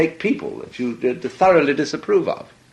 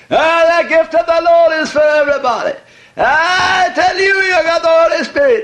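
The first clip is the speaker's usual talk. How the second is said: much higher and louder, fervent preacher's cry; revivalist preacher; 7 dB louder than usual. Retold or imitated. Imitating a radio revivalist to the audience, a high loud preacher's cry. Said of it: "he was saying".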